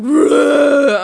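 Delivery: loud grunting roar; wrestler roar. Retold or imitated. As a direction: bellow like a wrestler. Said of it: "It's like".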